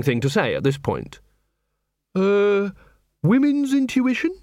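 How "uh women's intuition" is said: higher, unsure female voice; tentative suggestion. A narrator's reading. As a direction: offer it as a hesitant guess. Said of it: "she suggested".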